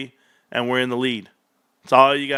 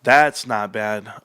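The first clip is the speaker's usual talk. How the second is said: ordinary delivery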